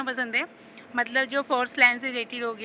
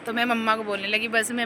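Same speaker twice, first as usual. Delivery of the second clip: ordinary talk, nothing marked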